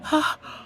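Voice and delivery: sharp gasp of shock; a quick breathless gasp at the news. Acted. Widow gasping on hearing her husband married.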